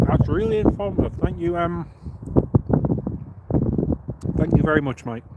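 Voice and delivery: talking through a mouthful of orange; muffled speech, mouth full; muffled, chewing. Real, not acted. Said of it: "Sorry I'm a bit muffled".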